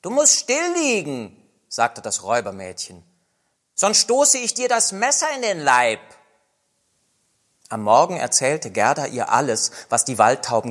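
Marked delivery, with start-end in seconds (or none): 0.0-1.3 put-on higher, bossy girl threat; robber girl voice. Robber girl orders Gerda to lie still, higher and bossy. 3.8-6.0 put-on higher, bossy girl threat; robber girl voice. Robber girl orders Gerda to lie still, higher and bossy.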